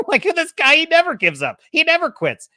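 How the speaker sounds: high, loud laughing marvel; amused disbelief. Retold or imitated. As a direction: voice an intrigued sceptic who can't help laughing. Voicing Zoro's amused intrigue at Luffy.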